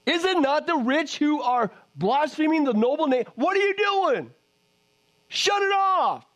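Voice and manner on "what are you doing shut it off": angry rebuke. Real, not acted. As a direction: demand it like a scolding preacher. Preacher rebukes blasphemers.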